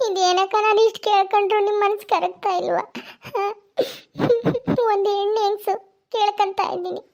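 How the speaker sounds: high-pitched voice of a weepy neighbour; tearful pleading woman. Acted. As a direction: beg tearfully for a favour.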